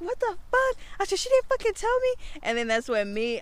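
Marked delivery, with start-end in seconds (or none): 0.0-2.1 high-pitched outraged venting; indignant retold complaint, high and incredulous; retold anger. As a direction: act out being mad, pitch shooting way up. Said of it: "I started getting more mad at Jada".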